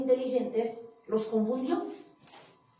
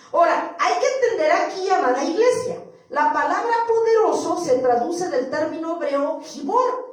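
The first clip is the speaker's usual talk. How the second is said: ordinary talk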